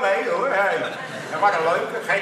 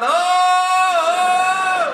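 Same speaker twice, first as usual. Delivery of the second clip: long, loud, high-pitched acted wail; screaming patient; 11 semitones higher, 8 dB louder than usual. Man in the next hospital bed, screaming "oh". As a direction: hold one drawn-out mock scream of pain. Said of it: "ligt de man te gillen van".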